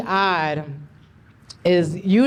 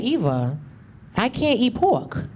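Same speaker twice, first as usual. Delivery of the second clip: slow, lightly voiced preachy friend; 4 semitones higher, slower than usual. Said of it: "She was like".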